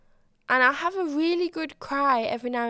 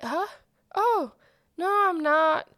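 awkward deflecting; 6 semitones higher, 4 dB louder than usual. Retold or imitated. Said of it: "I was so awkward in that situation".

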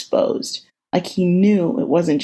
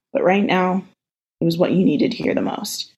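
ordinary delivery

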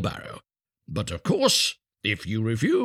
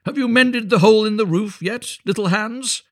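high, loud, hearty call; cheery voice; 13 semitones higher, 7 dB louder than usual. A narrator's reading. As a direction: call out cheerfully, pitched right up. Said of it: "in a cheery voice".

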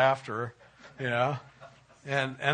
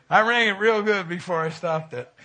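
louder, raised, laughing through it; laughing quote; 5 semitones higher, 8 dB louder than usual. Retold or imitated. Husband quoting his wife about the bell, laughing. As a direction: quote her with a giggle of mischief. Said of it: "she goes".

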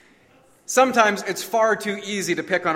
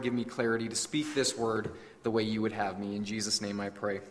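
low, soft, measured praying voice; prayer; 5 semitones lower, 5 dB quieter than usual. Real, not acted. Pastor praying for the congregation before preaching, low soft prayer. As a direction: pray earnestly, lower and quieter than preaching.

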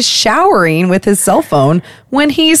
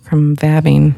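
whisper; 3 semitones lower than usual. Pretend. Joke about vabbing.

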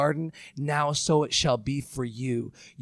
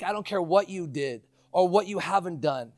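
ordinary delivery

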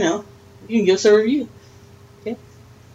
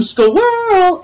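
squirrel; 13 semitones higher than usual. Acted. Sudden distraction, comic interjection.

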